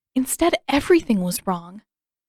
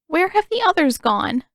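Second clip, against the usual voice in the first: put-on high, louder worried old woman; anxious elder asking; 9 semitones higher, 5 dB louder than usual. A narrator's reading.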